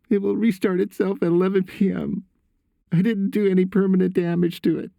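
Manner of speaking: put-on high-pitched boy explaining; upset son. A narrator's reading. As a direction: reassure Dad in a son's high voice.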